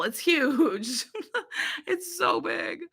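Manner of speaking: real laugh breaking into the words, voice going high and soft; amused, high laughing voice. Real, not acted. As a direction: giggle through the exaggeration. Laughs at her own exaggeration.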